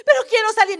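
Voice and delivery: protesta aguda y fuerte; súplica a gritos. Retold or imitated. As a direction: imita a una hermana encerrada que suplica. Hermana encerrada con la rata. (súplica gritada, en voz aguda)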